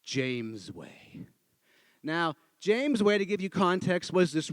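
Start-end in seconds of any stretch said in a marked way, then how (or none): 0.0-1.3 low, hushed, drawn-out reveal; slow and dramatic. Retold or imitated. Director showing Stanley the punchline, hushed and dramatic. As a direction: drop low and soft, milk the name. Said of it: "nice and dramatic".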